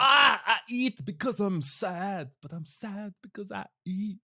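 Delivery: hammy Austin Powers villain; Fat Bastard voice. Retold or imitated. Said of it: "his fat bastard voice".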